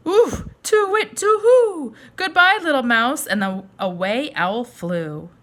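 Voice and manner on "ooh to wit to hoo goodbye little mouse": much higher and louder, hooting owl; frightened owl. Acted. Scared owl hooting goodbye to the mouse, high and loud. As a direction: hoot in alarm and flap away.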